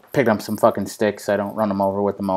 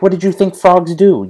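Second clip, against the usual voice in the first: put-on higher, louder, exasperated at the kid; incredulous dad voice; scolding his son for fearing a frog; exasperated question; 8 semitones higher, 7 dB louder than usual. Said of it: "I'm like".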